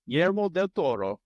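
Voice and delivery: name lilted with a light Italian accent; almost Italian accent. Real, not acted. Quiz host reading out the director's name, almost Italian accent. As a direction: slip into a hint of Italian on the name. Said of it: "said that in an almost Italian accent".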